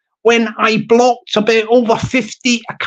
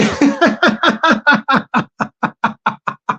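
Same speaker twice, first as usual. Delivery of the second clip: long wheezing giggle fit; helpless laughter; 3 semitones higher than usual. Real, not acted. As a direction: burst out laughing at your own impression. Laughs at own impression.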